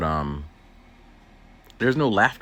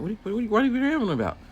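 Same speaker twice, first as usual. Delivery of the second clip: fast dismissive quote; much faster than usual. Retold or imitated. Friend brushing off rambling.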